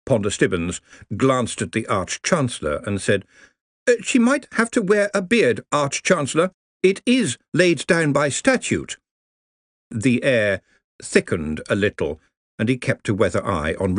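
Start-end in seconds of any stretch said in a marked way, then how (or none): 3.8-9.0 put-on higher, cautious young scholar voice; anxious junior wizard, careful; nervous young wizard. Ponder speaks to Arch-Chancellor.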